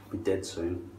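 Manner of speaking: ordinary talk, nothing marked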